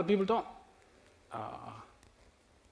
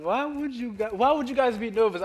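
high-pitched, louder mock bafflement; retold incredulous question, pitched up; puzzled; 7 semitones higher, 3 dB louder than usual. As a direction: act out not getting the worry. Retelling own baffled reaction to family.

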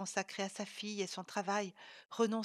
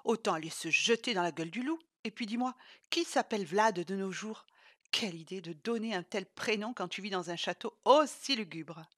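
louder, indignant friend; Sylvie scolding; 7 dB louder than usual. Acted. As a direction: scold her for the crazy idea. Sylvie scolds Isa about Vlad.

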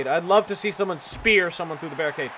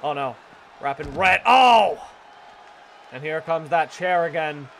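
ordinary talk

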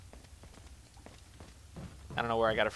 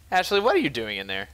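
normal talking voice

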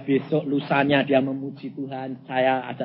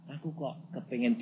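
timid request; 3 semitones lower, 9 dB quieter than usual. Retold or imitated. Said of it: "dia kalau minta ndak berani terus terang".

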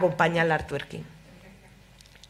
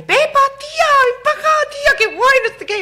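much higher, much louder squealing shout across the park; friends shouting hello; 9 dB louder than usual. Acted. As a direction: yell to her like excited teen girls. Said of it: "empiezan a gritarle".

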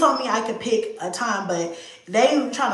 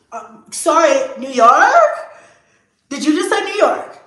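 excited disbelief; 6 semitones higher, 8 dB louder than usual. Retold or imitated. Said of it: "she was like".

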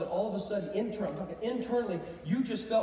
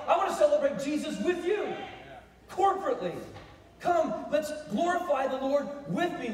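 raised, full-voiced rallying call; exhorting preacher; 7 semitones higher, 6 dB louder than usual. Preacher calling people to celebrate Jesus together, rousing and high. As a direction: urge the whole room to join in.

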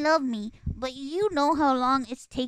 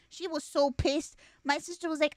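normal talking voice

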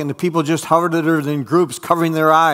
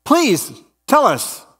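demanding plea; 10 semitones higher, slower than usual. Acted. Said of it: "demanded a third".

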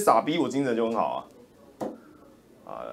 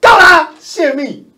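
mimicking the ministry, much higher, louder and slower; mock ministry voice; 12 semitones higher, 12 dB louder, slower than usual. A streamer parodies the foreign ministry, high slow sneer. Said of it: "然后外交部说".

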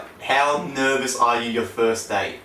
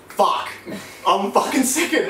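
panicked realisation; 8 semitones higher than usual. Retold or imitated. Realising he jumps second, high panicked yelp. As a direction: let the panic shoot the voice up.

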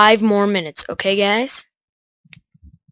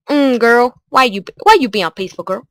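sassy scolding; 3 semitones higher, 4 dB louder than usual. Retold or imitated. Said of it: "I was gonna be like".

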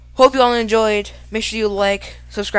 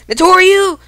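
high, loud attack call; shouted move call; 11 semitones higher, 7 dB louder than usual. Real, not acted. Calls out a move.

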